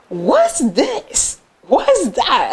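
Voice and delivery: high-pitched, swooping questions; shocked mom. Retold or imitated. Mom walking into the cluttered apartment, shocked. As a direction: imitate a mother's astonished double take. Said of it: "she was shocked".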